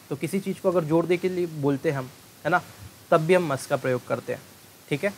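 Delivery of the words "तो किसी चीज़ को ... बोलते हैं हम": ordinary talk, nothing marked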